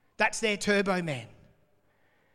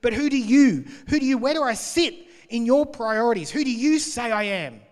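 raised, pressing voice with a higher pitch; urgent challenge; 6 semitones higher, 9 dB louder than usual. Retold or imitated. Jesus presses his disciples, raised and urgent. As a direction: push the question hard at them. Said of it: "And he said to them".

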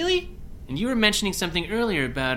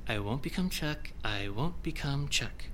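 much softer, lower repeated mantra; muttered mantra; 5 semitones lower, 10 dB quieter than usual. Acted. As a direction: repeat it under your breath. Repeated mantra under breath.

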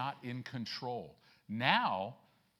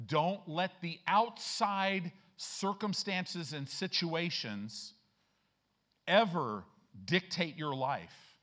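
loud, high, stretched-out preaching; full-force preaching; 5 semitones higher, 5 dB louder, much slower than usual. Acted. Preacher driving the verse home to the church, loud and slow. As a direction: preach it out to the congregation, big and slow.

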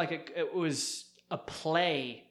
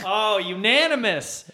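high, loud, slow drawn-out reveal; excited announcement; 6 semitones higher, 8 dB louder, slower than usual. Real, not acted. Host reveals the unanimous vote, loud and drawn out. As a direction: savor the word like a big announcement.

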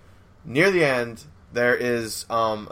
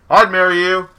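higher, loud call across a field; distant yell; 7 semitones higher, 9 dB louder than usual. Retold or imitated. One guy yells his proposal across the baseball field, loud yell. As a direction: holler it out to someone far away. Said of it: "yells from across the baseball field".

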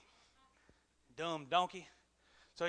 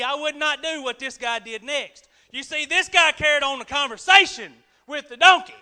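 ordinary talk, nothing marked

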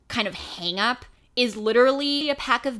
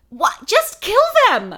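exasperated yell; 9 semitones higher, 7 dB louder than usual. Retold or imitated. Exasperated at Death Eaters.

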